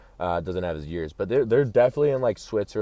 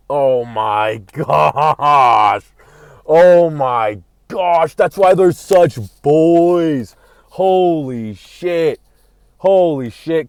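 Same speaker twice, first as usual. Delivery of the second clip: loud, high, drawn-out amazement, slow; amazed; 6 semitones higher, 12 dB louder, much slower than usual. Real, not acted. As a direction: stretch every word in stunned awe. Amazed at own theory.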